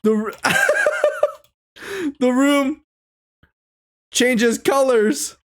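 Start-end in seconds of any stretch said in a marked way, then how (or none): 0.4-1.3 squeaky giggle; high breathy giggle; high-pitched laugh. Real, not acted. Laughing mid-reading.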